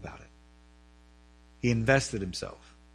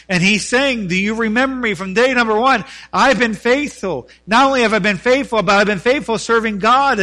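impassioned preaching; 7 semitones higher, 8 dB louder than usual. Acted. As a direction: preach it with rising fervor. Preaches at full force.

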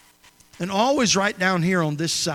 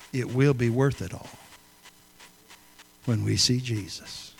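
spoken softly; 4 semitones lower, 3 dB quieter than usual. Real, not acted. Closing line recalled, spoken softly.

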